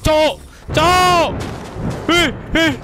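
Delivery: high panicked yells at a monster; panicked yelling. Real, not acted. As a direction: yell in sudden terror. Monster appears, both panic.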